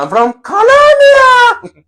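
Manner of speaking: very high, slow pageant-queen voice; beauty queen answer. Pretend. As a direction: gush it like a beauty pageant contestant. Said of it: "Si fuera el reinado".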